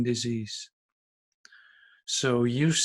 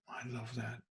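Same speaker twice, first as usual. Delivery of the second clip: "I love that" repeated much softer, almost under the breath; soft echo; 16 dB quieter than usual. Real, not acted. Pastor, savouring the reading, repeats it softly. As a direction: let the second "I love that" fall to a hush.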